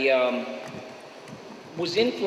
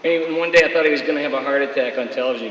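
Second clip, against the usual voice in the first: usual speaking voice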